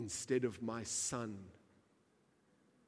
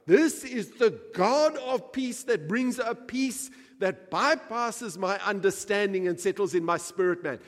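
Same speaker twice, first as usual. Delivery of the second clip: much higher, much louder preaching; preaching at full force; 10 semitones higher, 13 dB louder than usual. Acted. Preacher proclaiming the God of peace, full force. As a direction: thunder it out from the pulpit. Preacher erupts about shalom.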